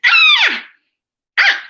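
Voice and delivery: "Ah!" shouted very high then dropping hard; falling fourth tone. Acted. Dentist story scream showing falling fourth tone, loud. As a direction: scream "Ah!" from way up crashing down. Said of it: "the dentist screamed".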